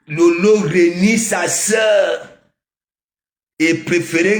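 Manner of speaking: drawn-out accusing shout; emphatic shout. Real, not acted. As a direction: hammer each word out slowly. Ranting about a rival to audience.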